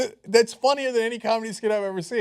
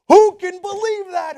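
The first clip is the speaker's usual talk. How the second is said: much higher, louder cry; outraged disbelief; 11 semitones higher, 11 dB louder than usual. Real, not acted. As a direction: shout your disbelief to the room.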